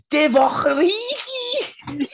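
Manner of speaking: slow, shouted Scouse accent, laughing; hammed-up Scouse yell of the name. Shown as a demonstration. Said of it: "the best name in a Scouse accent, accent ever".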